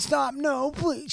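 high, soft pleading whimper; high, soft, breathy mock plea; imitating crying girl. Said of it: "she went".

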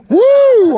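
very high, loud whoop; wild whoop. Retold or imitated. Showing his friends how he went nuts, high whoop. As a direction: let out a piercing crazed "woo". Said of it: "I went nuts".

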